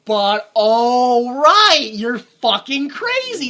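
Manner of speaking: loud, slow, strained cry of disbelief; drawn-out, half-laughing exasperated shout; exasperated yell. Real, not acted. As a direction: let it break into a shout. Exasperated outburst at Scientology.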